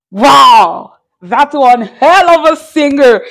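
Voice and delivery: loud, emphatic burst of awe; big loud wow, drawn-out emphasis; loud amazement. Real, not acted. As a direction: belt out your praise, blown away. Blown away as the song ends.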